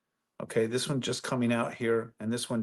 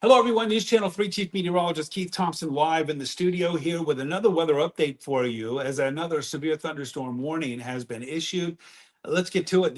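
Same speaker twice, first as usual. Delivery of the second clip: bright, higher, louder broadcast voice; news anchor read; 6 semitones higher, 5 dB louder than usual. Acted. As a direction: open the weather report on air. On-air broadcast opening.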